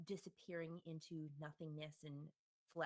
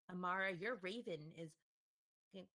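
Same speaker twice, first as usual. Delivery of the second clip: ordinary talk, nothing marked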